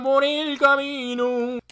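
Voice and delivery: high, very slow and sing-song; sing-song echo. Pretend. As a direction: lift it up playfully, slowly. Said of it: "echoes friend's phrase twice". (eco cantarín, agudo y lento)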